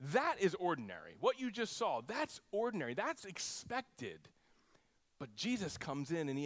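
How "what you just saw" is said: normal talking voice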